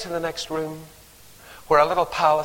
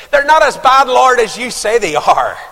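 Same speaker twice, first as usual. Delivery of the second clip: Paul bargaining with God; 6 semitones higher, 7 dB louder than usual. Retold or imitated. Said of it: "He says".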